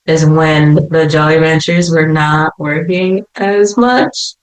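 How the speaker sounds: ordinary delivery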